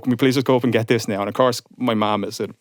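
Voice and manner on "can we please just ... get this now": fast, breathless begging, words tumbling out; rushed pleading kid. Retold or imitated. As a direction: hurry through it like a kid who can't wait. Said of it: "And I was like".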